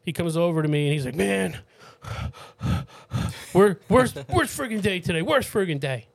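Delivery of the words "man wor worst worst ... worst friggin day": high, agitated retelling of a stranger; imitating a man venting about his awful day; worked-up venting. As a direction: blurt it out, worked up, stumbling on the first word. Acting out a distressed stranger's entrance.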